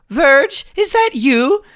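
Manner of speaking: high, loud, slow anxious call-out; nervous call. Acted. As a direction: call out high and scared toward the door. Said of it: "And they go".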